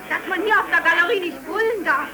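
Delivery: an angry heckler raising his voice over the crowd; yelling from the gallery. Acted. Heckler in the gallery yells at the speakers, loud and high.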